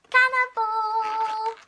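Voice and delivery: slow, drawn-out horrified cry; shocked cry. Acted. Kid sees fish eat fish, crying out slowly. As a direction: gasp it out, appalled.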